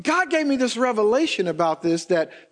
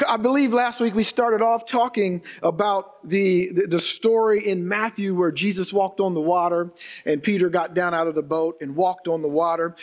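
ordinary talk

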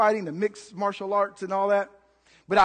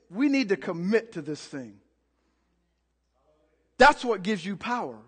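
ordinary talk, nothing marked

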